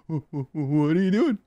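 high, quick stammered question; shorter stammering bit. Pretend. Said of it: "You gotta shrink it down".

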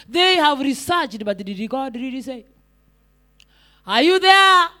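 usual speaking voice

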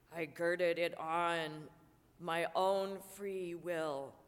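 low, slow, heavy ghost; mournful low spectre. Acted. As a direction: drag each phrase like the chain. Marley's ghost in reading.

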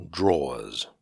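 DRAWERS drawn out long, with falling tune; struggling to pronounce it. Shown as a demonstration. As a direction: stretch DRAWERS out as a careful attempt. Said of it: "You can't say it properly".

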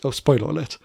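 normal talking voice

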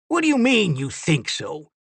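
high-pitched challenge, falling as it ends; indignant disbelief. Acted. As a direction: throw the doubt back at him. Bickering over the cannon.

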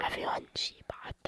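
secretive stage whisper. Real, not acted. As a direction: whisper it to the audience. Whispered tattling aside.

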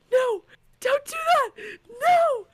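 high, slow crying no; very high mock sob of protest; mock wailing plea. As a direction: beg the author to stop, hammed-up. Reader pleading with author.

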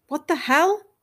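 loud. A narrator's reading. Husband reacts loudly to wife's confession. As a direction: blurt it out, stung. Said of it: "His voice was loud".

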